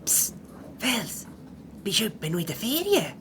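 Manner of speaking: hushed. Acted. Whispering to neighbour in class.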